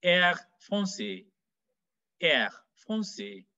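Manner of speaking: slow "air" and "français" with throaty uvular R; French R. Shown as a demonstration. Teacher demonstrates the special French R in "air français". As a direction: make the R in "français" at the back of the throat. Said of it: "It's a special R".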